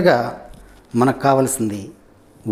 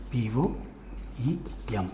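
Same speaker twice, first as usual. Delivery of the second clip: slow, softer letter-by-letter spelling; 2 semitones lower, 14 dB quieter, much slower than usual. Shown as a demonstration. Teacher spelling the next word, slowly spelled out. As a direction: spell it quietly with long gaps between letters. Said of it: "poem ఉంది".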